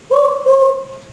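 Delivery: high loud hooting "Whoop, whoop"; owl call. Shown as a demonstration. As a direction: hoot it out like an owl for the toddler. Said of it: "An owl says".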